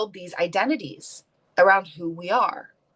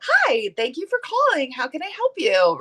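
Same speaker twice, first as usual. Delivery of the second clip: high customer service phone voice; chirpy phone greeting, pitched way up; Disney princess voice; 7 semitones higher than usual. Retold or imitated. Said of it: "my Disney princess voice".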